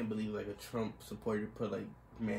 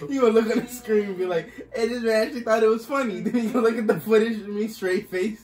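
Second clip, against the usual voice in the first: loud, high, cracking up; laughing while talking; 9 semitones higher, 11 dB louder than usual. Real, not acted. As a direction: talk through uncontrolled laughter. Cracking up at own joke.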